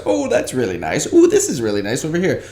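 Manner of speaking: lightly acted admiring oohs at products; a touch of commercial-style delight; product reaction. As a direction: ooh over products like a commercial audition. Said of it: "A lot of reactionary stuff, looking at products".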